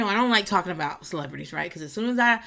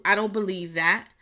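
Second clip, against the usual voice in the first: usual speaking voice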